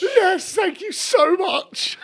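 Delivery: excited. Real, not acted. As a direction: yelp out your relief. Relief at winning.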